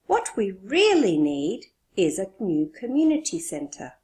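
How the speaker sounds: really need punched; stress on really need. Shown as a demonstration. Teacher leads the class in stressing really need. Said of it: "Speak with me".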